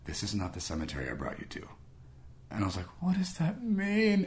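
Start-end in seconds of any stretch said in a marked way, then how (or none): 0.1-1.7 low, hushed divine message; quiet, low and solemn; subtle inner voice. Retold or imitated. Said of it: "It was so subtle". 3.0-4.2 slow, high-pitched puzzlement; drawn-out high mock confusion; bewildered. Retold bewilderment at the message.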